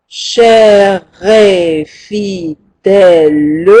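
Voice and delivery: Cher et fidèle drawn out slowly, each syllable spaced; syllable count. Shown as a demonstration. Teacher counting syllables of the verse, slowly. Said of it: "voyons si j'ai douze syllabes".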